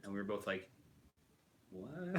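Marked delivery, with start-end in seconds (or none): 1.7-2.2 high, rising baffled "what"; disbelief, pitched up into a question; incredulous reaction. Retold or imitated. Both of them reacting to Lego's note, incredulous.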